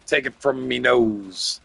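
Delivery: put-on slow growled pirate accent. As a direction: talk like an old pirate. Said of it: "Now you sound like a pirate".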